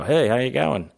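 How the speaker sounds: put-on Australian hello; Aussie voice. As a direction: try out an Aussie accent on a local. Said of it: "I go".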